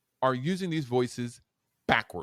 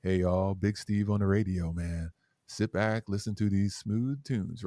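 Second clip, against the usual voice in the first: nighttime DJ voice; 5 semitones lower than usual. Shown as a demonstration. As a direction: croon it like a one AM DJ. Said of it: "That's nighttime D J voice".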